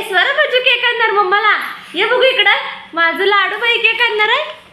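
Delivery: put-on high, loud, fond coo to a toddler; doting baby talk. Mother cooing at daughter who will bring cake, high fond baby talk. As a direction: gush over the little one.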